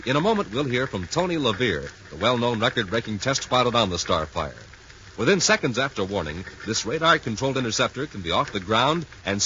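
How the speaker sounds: formal broadcast announcement; announcer read. Acted. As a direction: clear radio announcer delivery. Announcer reads sponsor message.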